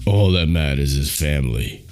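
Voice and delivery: low, deep movie tough-guy impression; mock action-hero voice, pitched low; Vin Diesel. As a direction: drop the pitch right down like an action star. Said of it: "Like, Vin Diesel would have this knife".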